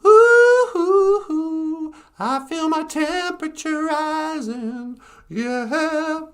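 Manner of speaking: very high, loud puppet cooing. Pretend. Puppet Natasha playing the Labyrinth bit, very high. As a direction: coo it as the puppet.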